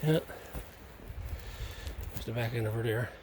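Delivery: heavy breathing between words; breathing hard while walking; audible breathing. Real, not acted. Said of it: "Sorry for the breathing, boys".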